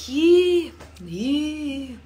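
"Ye, ye" stretched out, first one high and loud; elongated ye. Shown as a demonstration. Teacher shows learners the elongated "Ye" of dismay. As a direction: draw the "Ye" out long. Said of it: "But if you elongate it".

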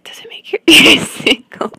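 slow, forceful stage whisper breaking into laughter; teasing ASMR whisper. Retold or imitated. Teasing the guy who said stop whispering, whispered with a laugh. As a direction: whisper it slowly, giggling.

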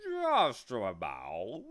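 hint of pride. A narrator's reading. Bee answering Milo's admiration, slow proud drawl. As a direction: stretch it with modest pride. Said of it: "a hint of pride in his voice".

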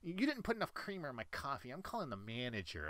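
mock whiny coffee complaint; whiny customer. Customer griping about creamer, lightly mocking whine. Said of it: "if someone is just whining".

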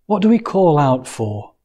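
ordinary talk, nothing marked

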